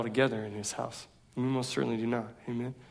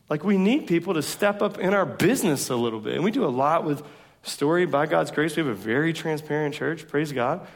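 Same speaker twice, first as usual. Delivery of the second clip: normal talking voice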